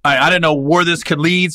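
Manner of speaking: normal talking voice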